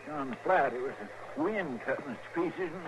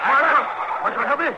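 much higher and much louder, straining; desperate call for help; 7 semitones higher, 9 dB louder, faster than usual. Acted. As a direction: shout for the marshal while holding on. Sidekick struggling, calls for help.

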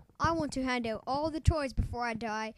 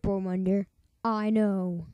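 usual speaking voice

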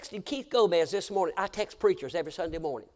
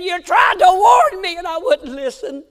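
anguished cry; 13 semitones higher, 16 dB louder than usual. Retold or imitated. Preacher voicing the grieving father, loud and high. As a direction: wail it out in regret.

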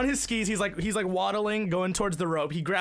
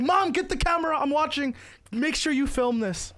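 raised, higher-pitched holler; imitated calling out; 6 semitones higher than usual. Man yells to his wife to film him, imitated holler. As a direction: call down the hill, acting the eager skier. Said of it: "he yells to his wife".

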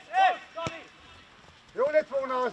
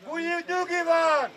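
steady the team from afar; shouted reassurance. Real, not acted. Coach tells players to keep calm, shouting.

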